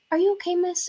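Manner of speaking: high-pitched voice of a worried woman; light, high, questioning; concerned stranger. Retold or imitated. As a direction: imitate a passerby gently checking in.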